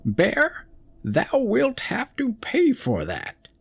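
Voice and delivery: very high, piercing angry chicks; angry young birds screaming. Acted. Young wrens screaming at the bear, furious and shrill. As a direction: shriek back at the bear in outrage. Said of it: "frightfully angry and screamed".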